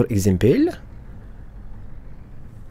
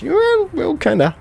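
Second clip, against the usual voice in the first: squeaky voice; slower than usual. Pretend. Squeaky aside about the garage.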